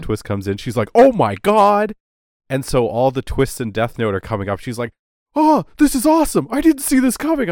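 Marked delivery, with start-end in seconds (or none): 0.9-1.9 high, loud and drawn out; floored by the twist; stunned gasp. Retold or imitated. Fiance reacting to film twist. 5.3-7.5 much higher, loud and gushing; bursting with delight; thrilled. Retold or imitated. Fiance thrilled by show twists.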